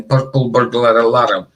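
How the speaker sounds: "Purple burglar alarm" with tongue rolling throughout; rolling R's. Shown as a demonstration. Scot showing how hard the accent is, rolled R's. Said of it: "rolling all the time".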